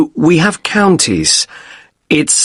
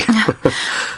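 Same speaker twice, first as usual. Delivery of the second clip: light, higher-pitched soft chuckle; short chuckle; 8 semitones higher, 6 dB quieter than usual. Acted. Guest jokes about his broken wrist to receptionist, soft chuckle. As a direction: chuckle softly at your own misfortune.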